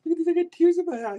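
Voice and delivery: fast, high, broken up by real laughter; blurting it out while crying with laughter; laughing to tears. Real, not acted. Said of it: "I'm crying".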